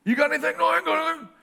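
hammed-up pew chatter; high mock buddies. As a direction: trade lines like rowdy drinking buddies.

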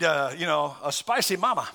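usual speaking voice

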